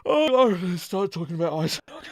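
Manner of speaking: high, excited mock enthusiasm; mock Canadian fan. Host mocking Canadians' eagerness for ice hockey, hammed-up. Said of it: "And we all go".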